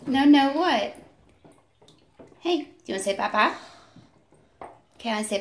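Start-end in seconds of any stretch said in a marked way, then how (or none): none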